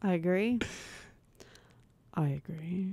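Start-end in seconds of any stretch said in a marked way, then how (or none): 2.1-2.9 low, soft, grave delivery; gravitas voice. Shown as a demonstration. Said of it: "That's, that's more gravitas".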